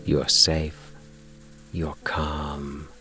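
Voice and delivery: plain reading voice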